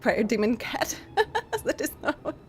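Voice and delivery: usual speaking voice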